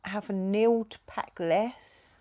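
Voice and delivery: slow, drawn-out pointed jab; teasing emphasis. Real, not acted. Wife teasing husband about packing too much, slow pointed. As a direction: stretch it out to tease Neil. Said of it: "slow teasing repeat".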